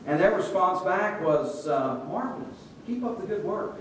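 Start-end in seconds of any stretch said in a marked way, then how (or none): 2.1-3.7 polite gushing. Retold or imitated. Guests' automatic polite replies, retold.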